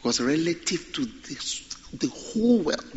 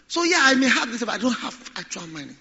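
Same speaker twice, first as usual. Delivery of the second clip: mock excuse; 7 semitones higher than usual. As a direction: plead poverty like a rich person. Mimics rich person pleading poverty.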